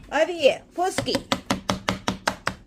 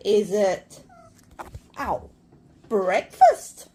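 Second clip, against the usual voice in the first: normal talking voice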